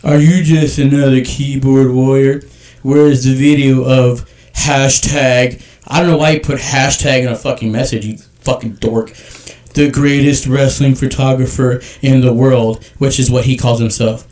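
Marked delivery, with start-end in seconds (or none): 0.0-5.6 slow, deliberate and louder reading. Shown as a demonstration. Reading the troll's message aloud, slow and louder. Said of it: "I'm gonna read this in a slow voice". 9.7-12.8 slow, louder mocking read. Shown as a demonstration. Reading the troll's message aloud, slow and louder. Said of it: "I'm gonna read this in a slow voice".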